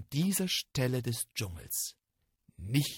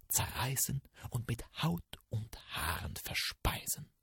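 ominous whisper; 8 dB quieter than usual. A narrator's reading. Tiger frisst das Hirschlein.

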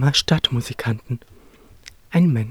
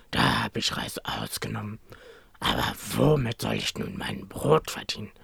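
low, worn-out dog voice; lower, dragging old dog grumbling about his fate; tired old dog; 3 semitones lower than usual. Acted. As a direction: sigh it out like a weary old hound.

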